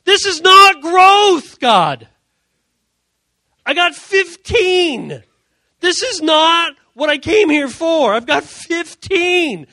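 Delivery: high, full-voiced, drawn-out shouting; outraged rant. Youth pastor rants at God over fifteen students, outraged shout. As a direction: yell your protest up at heaven.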